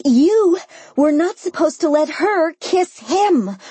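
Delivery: high, indignant accusation; sputtering outrage. A narrator's reading. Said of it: "I sputtered".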